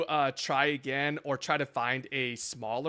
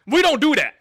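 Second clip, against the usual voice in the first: louder and higher, stress on "we"; firm insistence; 9 semitones higher, 9 dB louder than usual. Real, not acted. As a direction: lay down the rule loudly on "we". Firmly insisting they never dox.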